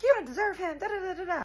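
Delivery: very high-pitched mimicry of a screamer; mock screaming rival. Storyteller mimicking the jealous rival who charges at her, mock screaming. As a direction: imitate her screeching, trailing into mocking filler. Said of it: "and she's screaming, crying".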